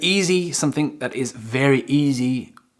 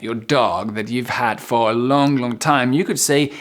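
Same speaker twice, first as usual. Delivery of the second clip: usual speaking voice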